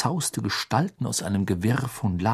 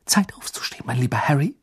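gentle unvoiced whisper; hushed, breathy wake-up whisper; 4 semitones higher, faster than usual. A narrator's reading. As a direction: whisper a tender wake-up call. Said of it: "flüsterte sie".

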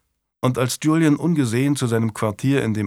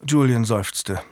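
plain reading voice

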